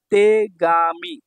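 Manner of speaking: তেগামি very slowly, each syllable held. Shown as a demonstration. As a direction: stretch তেগামি into three long beats. Teacher sounds out new word.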